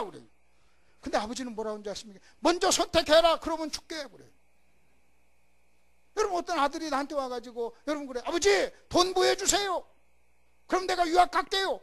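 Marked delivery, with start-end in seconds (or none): 2.4-4.1 high loud command from God; commanding Father. Acted. Preacher voicing God to the church, loud commanding. 8.2-11.8 high, loud pleading son; begging son. Acted. Son asking his father for study money, loud pleading.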